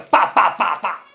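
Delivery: high, loud, punched syllables; staccato. Shown as a demonstration. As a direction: aggressive staccato ballet count. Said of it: "Mucho picadito". (picadito, agresivo y agudo)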